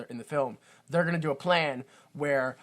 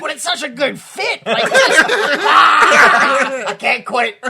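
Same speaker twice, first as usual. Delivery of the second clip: high, loud, laugh-choked words; helpless real laughter through the words; laughing hard; 9 semitones higher, 12 dB louder, much slower than usual. Real, not acted. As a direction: crack up while talking, bursting into a loud howl. Breaks up over a running joke.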